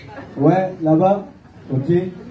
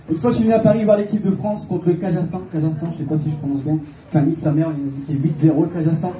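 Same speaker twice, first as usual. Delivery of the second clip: ordinary delivery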